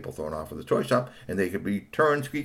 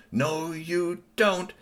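slow, higher, drawn-out refusal; defiant Tony; 8 semitones higher, much slower than usual. Acted. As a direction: stretch each word, digging in. Tony grabbing the toy.

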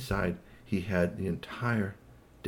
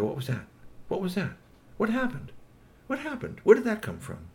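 rising, much higher alarmed questions; 12 semitones higher than usual. Acted. Watchers spotting the rocket, voices climbing high. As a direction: panic building with each question.

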